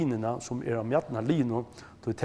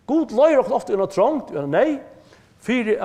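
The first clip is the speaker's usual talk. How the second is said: emphatic preaching; 8 semitones higher, 7 dB louder than usual. Real, not acted. Preacher raises voice on key point.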